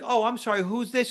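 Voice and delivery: a light put-on flirty woman; flirty Gloria. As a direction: play the innocent charmer. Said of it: "And Gloria's like".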